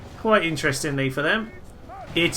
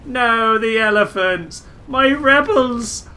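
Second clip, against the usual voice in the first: high, loud cry of dismay; anguished cry; 7 semitones higher, 6 dB louder, slower than usual. Real, not acted. Gamer crying out as his rebels fall, high and loud. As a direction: wail for his fallen rebels.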